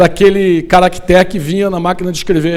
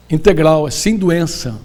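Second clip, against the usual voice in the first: ordinary delivery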